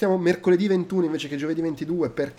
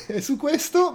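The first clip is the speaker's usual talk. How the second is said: breaking character giggling; 11 semitones higher than usual. Real, not acted. Host retries closing while laughing.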